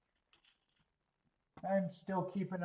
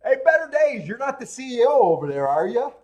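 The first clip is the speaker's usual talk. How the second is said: loud teasing; 6 semitones higher, 11 dB louder than usual. Real, not acted. Teasing viewer loudly.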